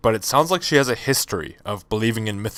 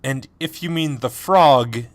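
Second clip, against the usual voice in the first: ordinary talk, nothing marked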